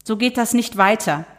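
high, slow, scolding; indignant neighbour. Acted. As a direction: Loretta complaining about the early hour. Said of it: "schrie Loretta".

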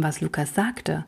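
ordinary delivery

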